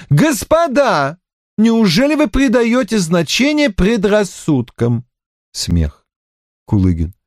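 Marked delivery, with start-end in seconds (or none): 0.1-5.1 loud. A narrator's reading. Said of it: "Раде громко".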